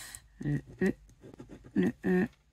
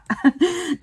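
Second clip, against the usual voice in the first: short laugh; 10 semitones higher, 3 dB louder than usual. Real, not acted. Laughs about not giving up.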